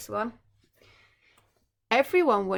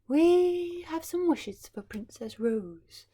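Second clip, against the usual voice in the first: a slow, higher lightly voiced fairy; 7 semitones higher, 4 dB louder, slower than usual. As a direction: gently offer the fairy's gift. Fairy speaking to king and queen.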